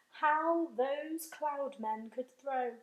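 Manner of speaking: ordinary delivery, nothing marked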